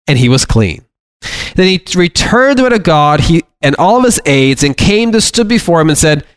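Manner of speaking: preacher reading scripture aloud from the pulpit. Acted. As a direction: read the passage to the congregation with weight. Reads Naaman scripture passage aloud.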